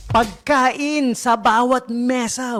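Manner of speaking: hammed-up campaign promise with conviction; imitating a politician's pledge. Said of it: "With feelings, eh. With conviction. Talagang diin na diin". (ginagayang pangako ng politiko, mabagal at madiin)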